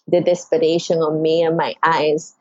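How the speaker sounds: normal talking voice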